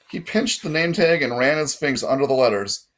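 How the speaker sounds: usual speaking voice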